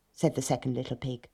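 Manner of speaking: ordinary delivery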